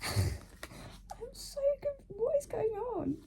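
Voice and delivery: high, teary, broken voice; confused sobbing through the words; real tears, pitch cracking high; crying. Real, not acted. Crying while reading twist.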